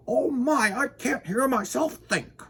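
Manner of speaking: high, flustered character voice; overwhelmed, high-voiced complaint; high-pitched fretting. A narrator's reading.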